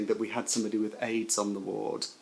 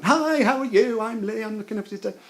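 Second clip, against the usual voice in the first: much higher, bright jolly nurse greeting, fast; bursting in cheerily; 11 semitones higher, 4 dB louder, faster than usual. Retold or imitated. Jolly nurse bursting into a patient's room, chirpy greeting. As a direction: burst in all chirpy and upbeat. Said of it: "I go bursting in as my one".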